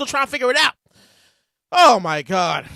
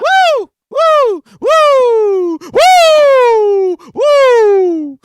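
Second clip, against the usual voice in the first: high loud whoops; party whoops; whooping; 8 semitones higher, 7 dB louder, much slower than usual. Real, not acted. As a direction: let out joyful woos. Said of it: "whooping about the party".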